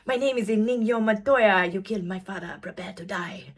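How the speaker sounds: hammed-up Spanish accent, movie duel line; a bad Spanish accent quoting The Princess Bride; terrible Spanish accent. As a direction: playfully fake a Spanish swordsman's accent.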